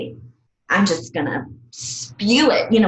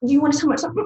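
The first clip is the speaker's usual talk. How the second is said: concerned asking; 8 semitones higher, much faster than usual. Retold or imitated. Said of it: "And then everybody's like".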